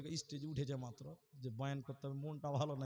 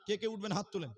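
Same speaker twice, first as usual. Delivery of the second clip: normal talking voice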